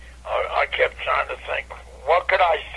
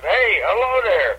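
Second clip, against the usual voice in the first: fans greeting; 7 semitones higher than usual. Retold or imitated. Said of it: "they said".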